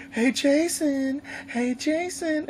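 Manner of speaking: high, slow sing-song taunt; taunting call. Retold or imitated. Cheating counselor on the roof taunting Jason, slow high sing-song. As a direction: drag it out to bait him.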